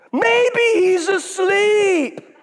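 high, very slow laughing jeer; mocking taunt. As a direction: drawl it out, laughing at them. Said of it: "starts taunting them".

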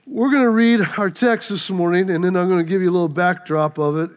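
normal talking voice

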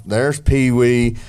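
ordinary delivery

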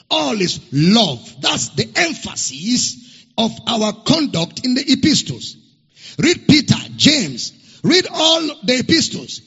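loud, insistent sermon exhortation; preaching at full force. Acted. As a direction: call the whole church to it, loud. Pastor exhorting congregation.

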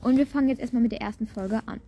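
ordinary talk

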